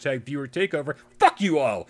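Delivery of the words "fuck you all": mock angry rant.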